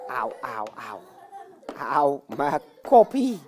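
low, soft groaning ows; play-acted pain. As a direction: moan over a bumped head, slowing down. Pop heeft hoofd gestoten. (gespeeld kermen van pijn)

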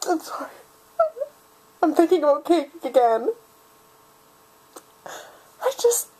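breaking into a whispered, high-pitched cry; crying, words slipping into a whimper; tearful. Acted. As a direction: squeak it out through tears. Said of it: "I promised myself I wouldn't cry".

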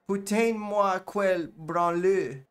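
slow, high French insult; French teacher sneering slowly; French accent. Acted. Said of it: "a French accent".

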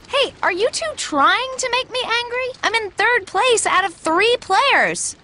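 indignant. Acted. Viola offended at bronze.

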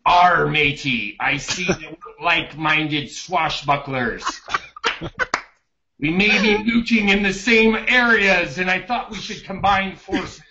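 hammed-up pirate hailing a rival. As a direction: growl it like a swashbuckler. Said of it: "one of the pirate phrases could be something like".

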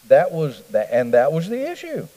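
ordinary delivery

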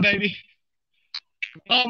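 usual speaking voice

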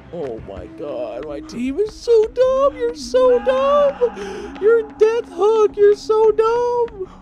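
high, slow put-on whine; mocking his own dumb team in a high, slow whine; mocking whiny voice. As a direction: mimic a whiny complainer, dragging it out.

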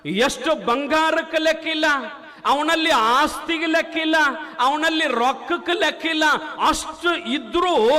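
high, ringing sermon voice; rising pulpit proclamation; fiery preaching. Acted. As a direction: preach it out to the crowd. Preacher raises voice.

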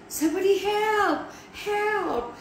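high-pitched cry for help; desperate yell. A narrator's reading. Max on the table calling for rescue, desperate yell. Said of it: "he yelled".

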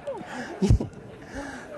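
long breathy burst of laughter; real laughter. Real, not acted. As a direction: laugh at the memory. Laughing after the story beat.

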